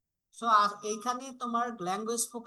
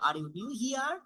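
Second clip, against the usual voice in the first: here rises clearly at the end; rising intonation; 4 semitones higher, much slower than usual. Shown as a demonstration. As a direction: lift the voice up on here. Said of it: "voice কিন্তু আমার up হচ্ছে". (শেষে here-তে গলা উপরে তোলা)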